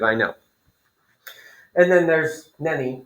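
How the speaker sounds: usual speaking voice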